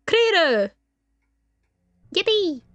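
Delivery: high, loud whoop; celebrating. Real, not acted. Player cheering a cleared chapter, high excited cry.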